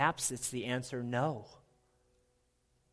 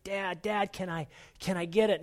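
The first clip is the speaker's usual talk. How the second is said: put-on pleading child; 6 semitones higher than usual. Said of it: "And he's like".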